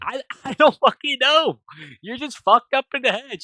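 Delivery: high, loud acted outburst; mock fed-up shouting; exasperated. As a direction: throw up the answer in frustration, half laughing. Captive's exasperated answer to captor.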